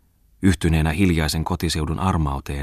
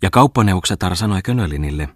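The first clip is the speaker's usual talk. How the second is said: ordinary delivery, nothing marked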